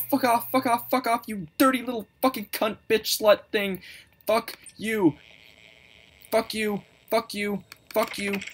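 high-pitched, frightened cursing; frightened, repetitive high outburst; panicked swearing. Real, not acted. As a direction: spit out curses in a high panic. Frightened swearing at game monster.

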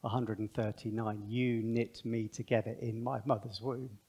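soft, slow, awed sermon voice; hushed reverent preaching. Real, not acted. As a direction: speak low and tender, in wonder. Reverent opening reflection on bodies.

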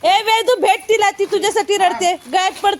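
high, lively announcing that Vedu has come; excited high calling. Real, not acted. Excited Vedu arrived, child crying. (Vedu आल्याचा आनंदाने उंच आवाजात सांगणे)

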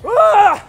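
loud high cry in icy water; cold shock yell. Real, not acted. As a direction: shout out from the freezing cold. Plunging into ice water.

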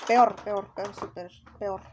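loud emphatic. Real, not acted.

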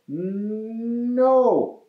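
high, long wind-up into a yell; hammed-up group refusal; storybook yell. A narrator's reading. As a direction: stretch the word and swell into it. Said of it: "Andrew and Julie all yelled".

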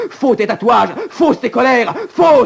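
builds from firm to a high, loud shout; escalating accusation ending in a high shout; rising accusation. Acted. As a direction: hammer each Faux harder until you explode.

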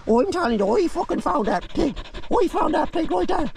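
high silly bragging dog voice; voicing the dog. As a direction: boast like the dog who won the fight. Dog voice insisting it found pig.

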